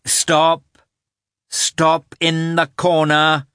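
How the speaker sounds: put-on high, loud command to a child; stern order. A narrator's reading. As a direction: order it loudly, stretching the words out. Mother orders child into corner.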